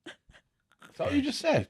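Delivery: high, slow incredulous question; mock disbelief. Real, not acted. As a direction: stretch it out in disbelief. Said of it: "teasing disbelief".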